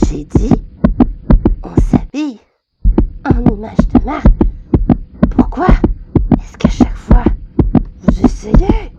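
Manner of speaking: loud, broken into hammered chunks; enraged shout with pauses between bursts; furious outburst. Acted. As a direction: lose your temper, shouting phrase by phrase. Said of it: "je me suis un petit peu emportée".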